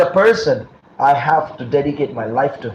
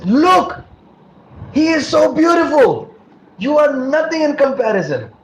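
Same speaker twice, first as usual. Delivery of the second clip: high-pitched, louder gushing outburst; entranced exclaiming; 13 semitones higher, 3 dB louder than usual. Acted. Wrestler telling his wife she is nothing beside the deity, entranced exclaiming. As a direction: exclaim it way up high, smitten with the beauty.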